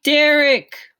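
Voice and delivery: high loud call across the house; mother calls out to husband in alarm; yelling. A narrator's reading. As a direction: shout for your husband. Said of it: "Mom yelled".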